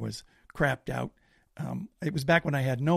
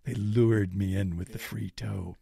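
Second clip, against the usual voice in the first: dread; 6 semitones lower than usual. Retold or imitated. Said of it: "And I'm like".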